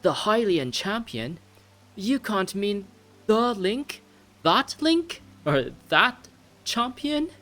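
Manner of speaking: high, incredulous put-on British accent; wing a high British accent, astonished. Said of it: "try to continue the accent".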